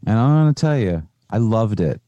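ordinary talk, nothing marked

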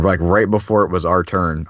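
ordinary talk, nothing marked

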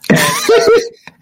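loud high nervous laugh; real loud high laugh. Real, not acted. Laughs at threat from Vader.

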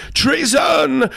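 very high shout of outrage; accusing cry. A narrator's reading. Gentleman with tied jaws joins in, crying high.

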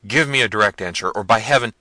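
loud, fast, exasperated demand; threatening prince. A narrator's reading. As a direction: demand an answer with rising menace. Manfred threatens the servants.

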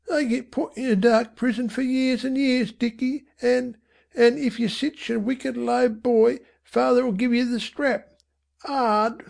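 high, halting earnest warning; earnest mother. A narrator's reading. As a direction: warn the boy in a high motherly voice. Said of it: "with what earnestness she might".

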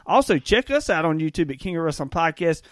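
ordinary talk, nothing marked

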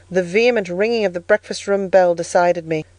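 plain reading voice

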